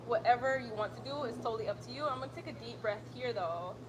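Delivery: calm, even yoga class cues; steady instructor voice pacing the breath; yoga instructor cueing. Acted. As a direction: guide the class through each breath. Leads a yoga flow.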